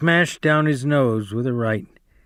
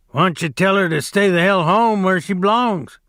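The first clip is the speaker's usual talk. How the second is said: higher, pushy jab at a rival; scornful Carlson; 6 semitones higher, 3 dB louder than usual. Acted. Carlson mocking Curly about his wife, raised and scornful. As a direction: taunt him with a raised voice. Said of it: "said Carlson".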